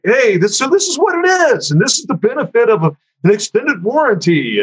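high, showy sales pitch; high-pitched entertainer's patter; song and dance entertainer. Retold or imitated. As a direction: pitch it like a showman, voice swooping up high. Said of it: "like a song and dance dude".

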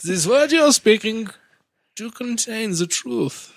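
high, slow, otherworldly sage; solemn oracle. Pretend. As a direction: intone it like a mystic oracle.

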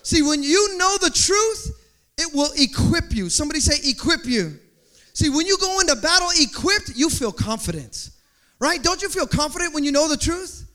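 loud, high, driving sermon; full-force preaching. Acted. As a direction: build and hammer every phrase.

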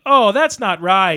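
loud, drawn-out mock dismay; slow it down and raise the volume and pitch; Rockwell's ad-lib. Quoting the actor's ad-lib to a co-host, loud mock dismay. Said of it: "Rockwell was like".

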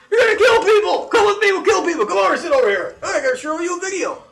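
drill sergeant rant. Retold or imitated. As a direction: rant at the kid like a gung-ho recruiter. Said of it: "he goes".

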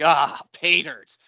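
gleeful-discovery demo with weight on "pay"; director's line reading. Retold or imitated. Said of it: "he even gave him the reading".

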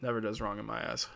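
ordinary talk

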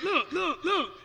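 high, insistent urging; excited urging. Retold or imitated. Elder showing pastor he walks, high insistent urging. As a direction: get him to look, excited.

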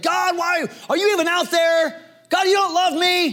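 high, pleading cry to God; anguished lament. Retold or imitated. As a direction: voice David's raw complaint, pitched well up. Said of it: "David's like".

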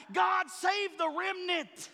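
preacher voicing his own fervent prayer for the lost; hollering prayer. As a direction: cry out to God on your knees. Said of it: "I'm on my knees hollering".

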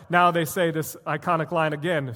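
normal talking voice

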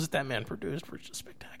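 hushed confiding whisper. Real, not acted. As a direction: lean in and whisper it. Aside after calling him lecherous.

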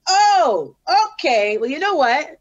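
loud, high "Oh", affronted retort; indignant. Retold or imitated. Rapper hearing she only gets a cut, indignant. Retold reaction to insulting pay offer.

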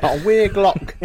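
high-pitched, badly faked Scottish accent. Shown as a demonstration. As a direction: put on a hammy Scottish accent. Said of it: "That was a shit Scottish accent".

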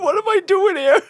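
mock whimper. Retold horror at the story.

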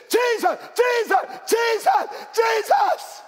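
high frantic shrieks of Jesus; wife screaming Jesus in panic on a ski boat; panicked screaming. Retold or imitated. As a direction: scream his name in terror on the boat. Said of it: "and she said".

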